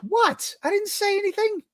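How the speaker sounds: high-pitched fake innocence; mock-innocent high squeak. Real, not acted. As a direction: voice jumps way up, playing innocent. Defensive denial, pitch jumps high.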